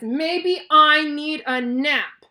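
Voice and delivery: loud, very slow, cross yell; cranky outburst. Acted. Piggy has caught her friend's crankiness, cranky yell. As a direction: bellow each word slowly and crankily. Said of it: "not only is Piggy cranky".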